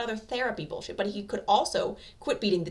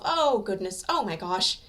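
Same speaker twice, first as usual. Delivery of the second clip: louder flustered gasping; flustered exclaiming; 3 semitones higher, 7 dB louder, slower than usual. A narrator's reading. Nervous office woman arriving, flustered exclaiming. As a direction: fret out loud, flustered. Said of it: "she's muttering to herself".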